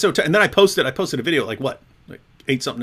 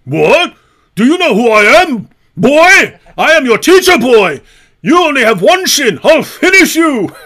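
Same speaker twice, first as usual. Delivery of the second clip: much higher, loud, strained bragging voice; mocking impression of Hijab; 9 semitones higher, 10 dB louder than usual. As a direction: squeal it like a puffed-up bully. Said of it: "That's not a character. That, that's how he actually talks".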